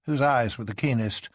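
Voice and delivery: usual speaking voice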